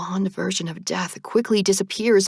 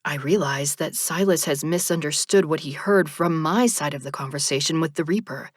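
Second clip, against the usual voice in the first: ordinary delivery, nothing marked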